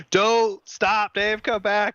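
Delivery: high, loud mock scream; imitating screaming. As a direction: call out in alarm, imitating a friend's yell. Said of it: "he's screaming".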